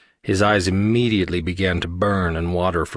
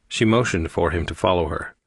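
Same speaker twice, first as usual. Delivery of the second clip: plain reading voice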